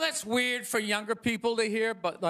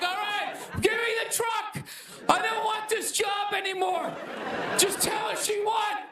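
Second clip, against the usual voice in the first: mock panicked shout; 8 semitones higher than usual. Comic surrendering to his bodyguards, panicked shouting. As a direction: yell it like you want out of the job right now. Panicked quitter after being shot.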